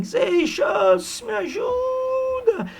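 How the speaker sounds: very high, slow, wailing mock damsel; hammed-up drunk plea. Drunk Aurélia begging Seixas for help, shrill hammed wail. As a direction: stretch it out like a melodramatic damsel. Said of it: "Ela diz assim".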